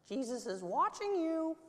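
high squawky parrot voice; eerie high bird voice. Acted. Parrot voice echoing in dark.

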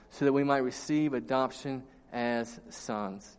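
scripture reading. Acted. Reads scripture passage aloud.

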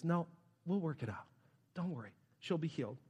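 very soft, low and gentle; quietly soothe, with pauses between phrases; compassionate reassurance. Retold or imitated. Jesus reassuring Jairus about his daughter, tender and hushed. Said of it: "loving, compassionate words from Jesus".

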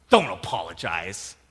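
loud, abruptly falling retort; imitated yell. Wife refusing husband's apology, imitated yelling. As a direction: cut off the apology with a mock yell. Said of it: "She just kept yelling".

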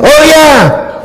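put-on high thrilled kid yelp; eager kids' yes. Kids eagerly accept a trip to Disneyland, high and thrilled. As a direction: jump at the offer like a delighted child.

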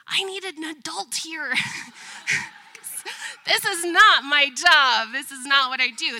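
mock panic. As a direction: throw up your hands, this is not my job. Mom panicking in front of kids.

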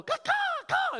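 volley of high crow calls; very high mock bird cries; imitated crow caws. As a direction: caw back like a chattering crow. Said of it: "And then I'll go".

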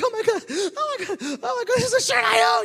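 high, loud mock squeal of a laughing wife; imitating wife. Husband imitating his wife calling him stupid, high squealing. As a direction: shriek it like his wife cracking up. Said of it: "she's like".

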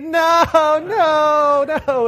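loud, high mock toddler shriek; retold screaming. Dad imitating daughter as he opens door, high screamed refusal. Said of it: "she's, like, screaming".

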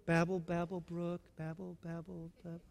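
very soft, murmured babble, babble; soft babbling. Shown as a demonstration. Pastor mocking worship that sounds soft as a brook. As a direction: hush it down like a trickling brook.

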